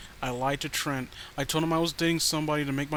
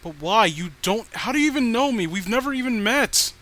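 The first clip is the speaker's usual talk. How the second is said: higher, louder panicked shouting; frantic yelling; 7 semitones higher, 8 dB louder than usual. Acted. Narrator shouting at Danielle that they never met, panicked yell. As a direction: yell it in disbelief.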